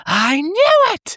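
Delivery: very high, slow triumphant shout; triumphant cry. Retold or imitated. Buffy unmasking Kathy, high drawn-out triumph. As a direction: crow with victory. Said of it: "she's like".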